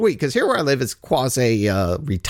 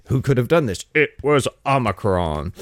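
cartoon villain. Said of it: "like some, uh, cartoon villain".

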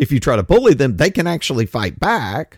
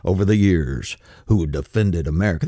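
mock patriot; 12 semitones lower, 3 dB quieter than usual. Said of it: "and they're like".

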